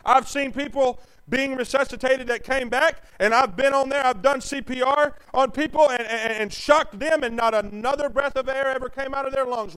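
loud, high shouted preaching; fiery preacher shout; full-force preaching. Acted. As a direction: hammer it home from the pulpit.

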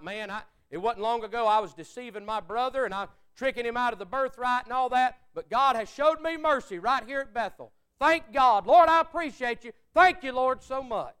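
loud, high rising praise; fervent thanks. Retold or imitated. Jacob at Bethel thanking God, loud and high.

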